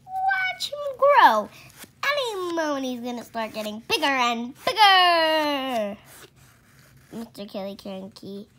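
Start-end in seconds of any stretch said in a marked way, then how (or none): 0.1-6.0 high, loud, laughing excitement; gleeful loud anticipation; excited. Acted. Father gleefully awaiting the cockerel to grow, laughing.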